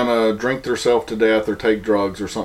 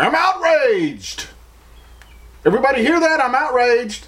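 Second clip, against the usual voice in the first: mocking outraged poster; 10 semitones higher than usual. Mocking people who post outrage online, high parody voice. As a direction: ham up fake outrage for applause. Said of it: "the instant response of everybody is".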